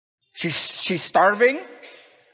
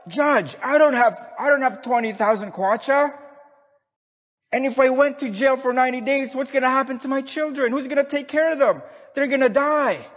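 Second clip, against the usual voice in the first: desperate pleading; 9 semitones higher than usual. Acted. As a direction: plead for your children in a raised, breaking voice. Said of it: "She fell to the floor and wept".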